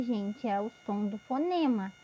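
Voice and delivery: ordinary talk, nothing marked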